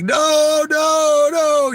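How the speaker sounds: high, loud panicked yelling of no; frantic shouting no. Retold or imitated. Security guard yelling at the fan running at De Niro, high and loud. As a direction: yell it sky-high like a guard trying to stop a charging fan. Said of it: "the security guy's like".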